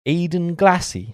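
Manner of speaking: high, slow posh British lilt; plummy English-accented name. Pretend. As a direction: say it like a posh colonial toff. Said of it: "Sounds very posh".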